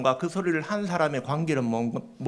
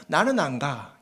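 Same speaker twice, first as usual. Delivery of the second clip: dismissive non-churchgoer; 8 semitones higher than usual. Acted. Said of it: "어떤 사람들은 말할 것입니다".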